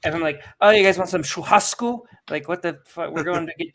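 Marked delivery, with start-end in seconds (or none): 0.6-2.0 "churrasco" said the Brazilian way, louder and raised; pretentious correct pronunciation. Retold or imitated. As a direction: showily correct Portuguese on "churrasco". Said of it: "like a pretentious douche if I pronounce things correctly".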